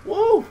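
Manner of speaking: high, loud woo; sudden high-pitched gamer whoop; excited whoop. Real, not acted. Excited whoop in live gameplay.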